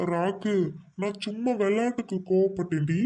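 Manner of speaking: high, wheedling excuse; meek placating. Acted. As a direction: plead sheepishly with an angry wife. Beaten husband placates his wife.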